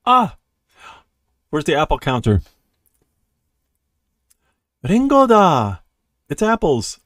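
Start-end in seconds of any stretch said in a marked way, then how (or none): 0.0-0.4 short, high, loud gasp of surprise; sharp acted exclamation; startled cry. Character spots fallen apples. 4.8-7.0 high, loud delighted cry; thrilled, acted shout; excited discovery. Character joyfully finds apples.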